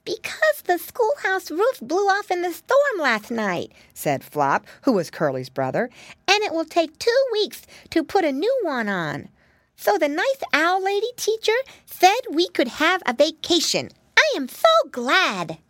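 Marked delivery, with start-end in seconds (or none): 0.0-3.7 put-on high, slow little-boy voice; little piggy brother, excited. A narrator's reading. Piggy brother explains the closed school to mama, excited. 6.3-15.6 little piggy brother, excited. A narrator's reading. Piggy brother explains the closed school to mama, excited.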